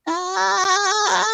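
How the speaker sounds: high clucking hen call. Retold or imitated. Talking to the chickens in their language, high hen cluck. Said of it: "and I said".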